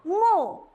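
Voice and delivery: मो said high as a held syllable; syllable demo. Shown as a demonstration. Teacher to children, मो sounded out high. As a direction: call out मो for the class. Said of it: "उच्चारण क्या हो जाएगा".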